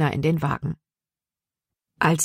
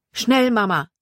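higher-pitched, hurried call; urgent call; 6 semitones higher than usual. A narrator's reading. Son urging his mother to hide quickly, urgent call. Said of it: "rief Jordan".

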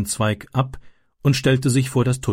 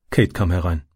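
ordinary delivery, nothing marked

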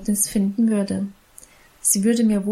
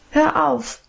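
higher, sudden cry of protest; unruly interruption; 5 semitones higher than usual. A narrator's reading. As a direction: cut in impatiently. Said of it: "fuhr ich ungebärdig dazwischen".

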